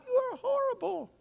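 high sneering accuser, voiced lightly; devil's taunt. Pastor voicing the devil beating up churchgoers, with a high sneering taunt. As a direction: jab at them like a lightly sketched devil. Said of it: "He beats you up".

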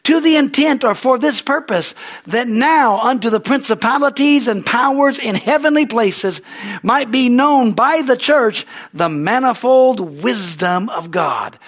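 slow, weighty scripture proclamation; scripture reading. Acted. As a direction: proclaim the verse to the congregation.

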